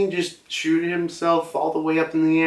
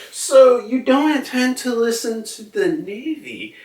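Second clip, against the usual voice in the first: much higher, light put-on woman's voice; voicing Stussy; 11 semitones higher than usual. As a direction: tease him in a lighter voice. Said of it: "Stutzi's like".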